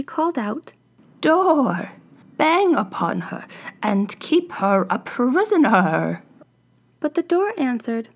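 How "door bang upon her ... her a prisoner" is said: loud, slow furious witch command; choking with rage. A narrator's reading. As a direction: bark the order at the door. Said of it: "so angry that she nearly choked".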